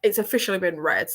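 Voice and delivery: ordinary delivery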